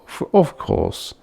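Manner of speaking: plain reading voice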